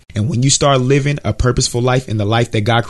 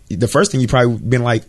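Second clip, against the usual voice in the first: normal talking voice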